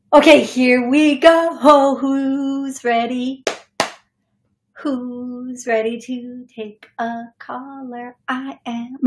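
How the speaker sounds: slow, bright host call-out; upbeat host. Real, not acted. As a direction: announce the caller segment. Opening the call-in segment.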